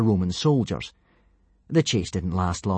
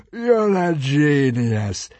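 slow high gurgling consul; 5 semitones higher, 6 dB louder, much slower than usual. A narrator's reading. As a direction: gurgle it with greedy delight. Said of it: "gurgled the fat consul".

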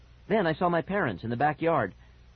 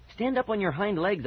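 higher, urgent instruction; mildly insistent direction; instructing; 8 semitones higher than usual. A narrator's reading. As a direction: coach a friend quickly, pitched up. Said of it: "I instructed Fergie".

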